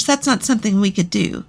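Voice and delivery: ordinary delivery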